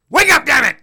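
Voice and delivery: high, loud mock shout; mock yell at referee. Wrestler yelling at the referee, hammed-up loud shout. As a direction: yell at the ref to wake up. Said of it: "he's like".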